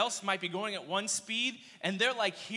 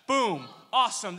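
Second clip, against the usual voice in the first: loud, punchy exclamations; excited; 8 dB louder than usual. Real, not acted. Preacher hyping the buffalo chicken sandwich, loud and excited. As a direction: slam each word out with glee.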